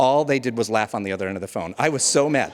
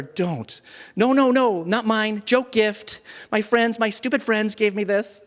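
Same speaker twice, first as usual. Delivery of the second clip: frantic pleading; 10 semitones higher than usual. Retold or imitated. Man begging the social worker not to report him, high frantic pleading. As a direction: beg her to believe you, near shouting.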